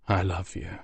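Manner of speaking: hushed, lingering farewell; very softly. Acted. Father saying farewell to his son, very soft. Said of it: "he said, very softly".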